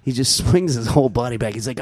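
ordinary delivery

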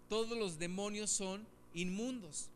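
usual speaking voice

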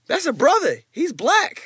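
high, loud excited quote; excited exclaim. Retold or imitated. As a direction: blurt it out in amazement. Retold excited recognition.

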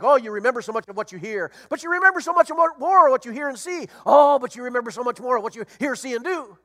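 quoting a catchy saying, rising high and loud; singsong saying. Retold or imitated. Preacher quoting a catchy saying to the congregation, lilting and loud. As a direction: rattle off the popular saying with a lilt. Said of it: "we say things like".

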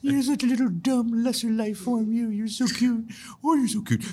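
put-on very high, squeaky baby-talk coo; cooing at puppies. Host answering haters as if cute puppies, cooing baby talk. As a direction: gush in falsetto as if to a tiny pet. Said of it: "you imagine that they're little bunny rabbits or little puppy dogs".

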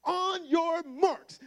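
high, very slow race call; race starter. Retold or imitated. Brother starting the race, drawn out and high. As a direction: draw out the start like a referee. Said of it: "my brother goes".

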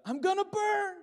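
high, loud mock wail of a child; hammed-up shriek of doom; dramatic cry. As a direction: imitate a melodramatic boy crying out in panic. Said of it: "he cried out".

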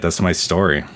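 usual speaking voice

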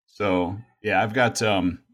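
usual speaking voice

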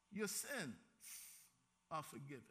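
soft and low, a gentle pardon; hushed, tender forgiveness. Acted. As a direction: lay it on him softly, like a gift. Jesus speaks to paralyzed man.

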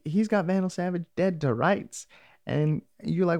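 ordinary talk

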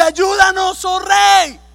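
high, loud desperate plea; woman crying out. Acted. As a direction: call out to the king for help. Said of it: "una mujer le grita".

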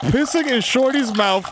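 ordinary delivery

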